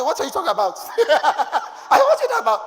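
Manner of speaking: loud, high, laughing protest; mock-incredulous laughing shout; laughing disbelief. Retold reply to wife, laughing.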